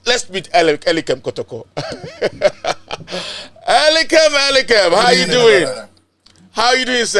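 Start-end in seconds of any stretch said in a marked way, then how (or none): 1.7-3.6 short amused laugh. Real, not acted. Host laughing as the guest joins, amused. 3.7-7.2 higher, louder calling out the name; excited greeting. Real, not acted. Host greeting his friend on the call, excited and loud. Host calls guest on line.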